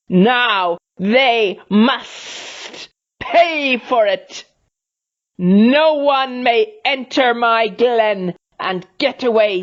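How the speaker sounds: high, slow menacing creature voice; angry Snagron. A narrator's reading. Snagron warns of punishing intruders, high and menacing. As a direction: hiss out the threat like a cruel guardian.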